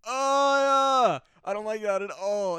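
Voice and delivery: high, loud put-on whiny voice; mock voice. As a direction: squeal it out in a mocking voice. Mocking a reaction.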